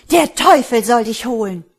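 high, very loud angry yell; furious curse. A narrator's reading. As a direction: hurl the curse at him. Enraged woman curses the man.

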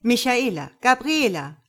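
loud, high summons; commanding. Acted. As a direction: call the guards to order. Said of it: "befiehlt sie".

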